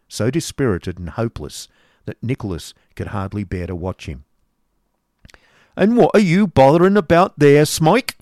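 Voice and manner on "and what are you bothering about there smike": loud, high scolding shout; cried out. A narrator's reading. Schoolmaster scolding the boy Smike, loud and high. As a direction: bark at the boy to stop. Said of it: "cried Mister Squeers".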